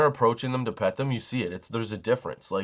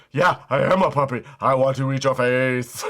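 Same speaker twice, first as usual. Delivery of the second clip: a lightly voiced menacing dog, a bit louder; voicing the dog; 4 dB louder than usual. Owner voicing his dog, lightly mock menacing. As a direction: give the dog a mock sinister voice. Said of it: "that dog is just going".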